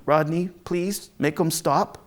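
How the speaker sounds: usual speaking voice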